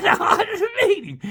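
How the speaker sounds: high laughing words; laughing eagerly. Real, not acted. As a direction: laugh through it with relish. Eager to meet Magic Man.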